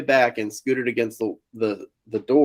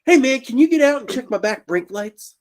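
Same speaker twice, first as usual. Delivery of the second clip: much higher casual favor; sudden friendly ask; 11 semitones higher than usual. Retold or imitated. Brother asks for brake light check, suddenly breezy.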